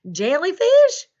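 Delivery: loud, high, rising disbelief; baffled question. Pretend. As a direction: ask it as if you have never heard the word. Farmer baffled by unknown word.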